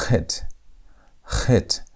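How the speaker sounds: "Chet" begun with the CH of German Bach; guttural CH. Shown as a demonstration. Teacher introduces letter number eight, guttural CH. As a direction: say "Chet" with a throat sound between K and H. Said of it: "you have to pay extra attention".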